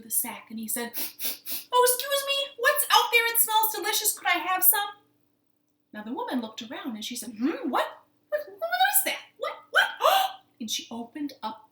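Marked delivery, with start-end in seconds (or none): 0.9-4.9 put-on very high, loud child voice; squeaky, excited kid calling out; little boy. Little boy calls from sack. 7.3-10.6 high, loud bewildered questions; acted puzzlement, jumping up in pitch; startled woman.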